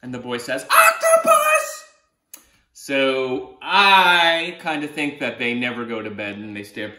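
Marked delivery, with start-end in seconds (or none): 0.7-1.8 very high, louder, drawn-out wail of the name; long, high, exasperated shout; exasperated cry. Acted. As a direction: call him out for the prank. Boy exasperated at jokes.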